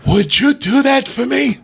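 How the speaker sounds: a hint of an old grandpa, drawn out.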